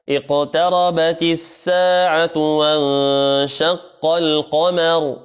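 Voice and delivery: very slow chanted recitation; tajweed chant; Quran recitation. Acted. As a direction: recite the verse slowly and melodically.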